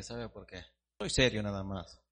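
normal talking voice

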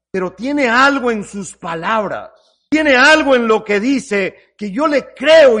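building from musing to loud conviction; Peter's doubt swelling into belief; voiced inner thoughts. Preacher voicing Peter's rising conviction.

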